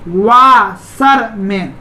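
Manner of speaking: Washerman very slowly, pausing between वा, शर, मैन; three long separated beats on washerman; split into syllables. Shown as a demonstration. Teacher showing it divides in three, split into syllables. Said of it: "तीन syllable में बंट रहा है".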